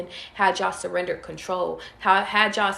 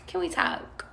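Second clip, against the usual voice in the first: feminine flirty; 8 semitones higher, 5 dB quieter, slower than usual. Pretend. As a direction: tease them with a soft flirty hush. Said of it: "I just feel like being feminine tonight".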